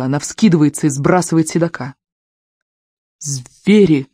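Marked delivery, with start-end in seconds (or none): 3.2-4.1 mild outraged cry. A narrator's reading. Servant under attack in the snow, crying out. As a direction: call it out in horror, held back. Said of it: "кричит он".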